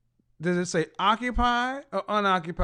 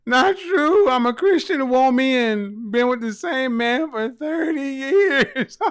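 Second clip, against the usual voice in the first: much higher and louder mimicry, breaking into laughter; mocking quote; 5 semitones higher, 7 dB louder, slower than usual. Host mocking caller Karen's words, high loud mimicry. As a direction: squeal her words back at her. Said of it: "She said".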